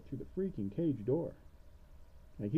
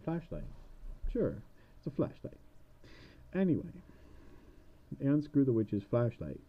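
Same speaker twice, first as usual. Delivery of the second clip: ordinary delivery, nothing marked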